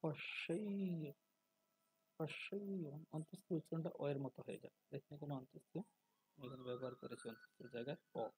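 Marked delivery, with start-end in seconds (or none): 0.0-2.9 পশ্যেয় with a long, drawn-out ending sound; Sanskrit pronunciation. Shown as a demonstration. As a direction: slowly demonstrate পশ্যেয়, stretching the final glide. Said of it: "সংস্কৃত ব্যাকরণের নিয়ম অনুযায়ী". (পশ্যেয় টেনে সংস্কৃত উচ্চারণে)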